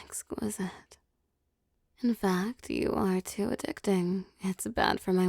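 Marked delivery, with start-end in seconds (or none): 0.0-1.0 savoring murmur. Acted. Savoring the captured prey.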